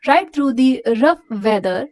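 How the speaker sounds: slow, careful reading to a class; measured textbook reading; slow read-aloud. Acted. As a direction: read it out slowly for students. Teacher reads story aloud.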